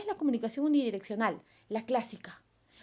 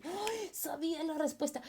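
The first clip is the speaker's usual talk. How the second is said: inner voice; 6 semitones higher, 4 dB quieter than usual. Retold or imitated. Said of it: "¿qué cosa dices en tu mente?".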